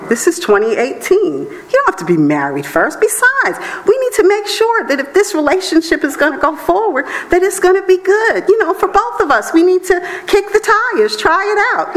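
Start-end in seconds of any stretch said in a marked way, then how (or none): 3.0-12.0 mock smooth talker. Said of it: "mock seducer excuse".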